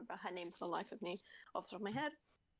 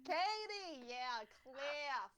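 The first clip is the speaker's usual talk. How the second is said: stuck in character; 3 semitones higher, much slower than usual. Acted. Game hero recalling partner's name, put-on American accent. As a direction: exclaim in the cartoon accent, then fade. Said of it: "I get into character".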